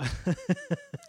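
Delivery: a sudden high squeal of laughter. Real, not acted. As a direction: let a high giggle burst out. Laughs at the in-character idea.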